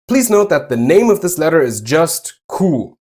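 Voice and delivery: ordinary talk, nothing marked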